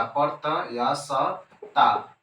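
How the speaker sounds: प्रत्यास्थता stretched syllable by syllable, very slow; drawn out slowly. Shown as a demonstration. Teacher drilling प्रत्यास्थता for students, very slow and drawn out. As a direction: spell out प्रत्यास्थता slowly so the class can copy it. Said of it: "समझ लेते हैं".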